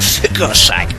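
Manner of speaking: ordinary talk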